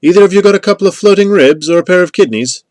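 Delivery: high, loud call for goods. A narrator's reading. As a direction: call the question out to both of them. Traders calling across a dark hillside.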